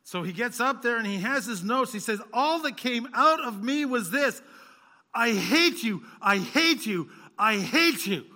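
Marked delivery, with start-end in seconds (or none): 2.3-8.2 loud, anguished cry of hatred, much higher; anguished shouting. Retold or imitated. Pastor Cho crying out his hatred to the pastors, loud and anguished. Preacher voicing Cho's outburst.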